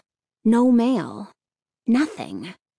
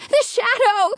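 high-pitched frightened cry; startled, much higher outcry; dazed cry; 12 semitones higher than usual. A narrator's reading. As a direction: cry out in alarm, pitch shooting up. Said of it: "she cried, feeling dazed".

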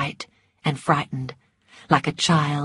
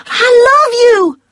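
much higher, louder outburst; passionate cry; 10 dB louder than usual. A narrator's reading. Scarlett crying out her love to Ashley, loud and high. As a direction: throw it at him with everything. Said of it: "she cried".

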